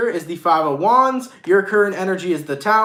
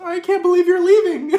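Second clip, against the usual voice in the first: much higher, hammed-up mock sadness, laughing; fake sad face; 14 semitones higher, 5 dB louder than usual. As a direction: fake a tearful goodbye you don't mean. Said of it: "Put on your sad face".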